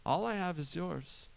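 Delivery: a father softly reassuring his son; gentle father. Retold or imitated. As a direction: hush it like a patient parent. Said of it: "All the father wanted".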